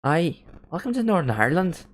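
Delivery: high, swooping Northern Irish lilt; Northern Irish accent. Pretend. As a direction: put on a stronger Northern Irish accent, rising at the end. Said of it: "does that mean the accent was good".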